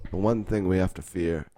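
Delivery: low, hushed solemn quote; grave quote. Retold or imitated. Malcolm X quote dropped low.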